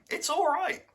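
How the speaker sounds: imitated viewer. Quoting people online.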